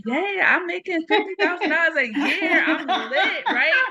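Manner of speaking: high-pitched, giddy re-enactment of delight; naive excitement. Retold or imitated. Author acting out her younger self's first-job joy, excited. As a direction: crow it like a first-timer who feels rich.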